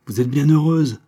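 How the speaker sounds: loud, high and biting; sour retort. A narrator's reading. Marie answers madame Sainte-Juste, loud sour retort. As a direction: snap it back at her, high and loud. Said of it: "riposta aigrement Marie".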